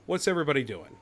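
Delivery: ordinary talk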